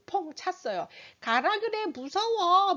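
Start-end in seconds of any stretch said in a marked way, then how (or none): 1.2-2.7 put-on high, slow whining kid; child's whiny protest. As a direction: whine like a scared six-year-old. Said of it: "막 이렇게". (떼쓰는 아이 목소리)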